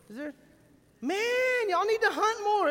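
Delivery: ordinary delivery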